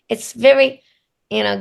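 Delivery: normal talking voice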